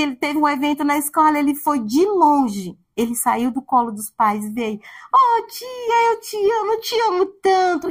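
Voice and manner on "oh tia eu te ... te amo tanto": put-on high child voice, gushing; imitating a small child's affection; little child. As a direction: coo it like a little boy hugging his teacher. Child runs to teacher quoted.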